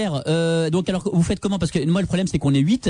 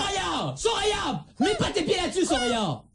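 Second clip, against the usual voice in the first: put-on yelling at a kid; 11 semitones higher, 6 dB quieter, slower than usual. Prankster yells at pretend child.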